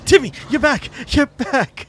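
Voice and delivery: high joyful cry; excited relief. Acted. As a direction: shout with relief.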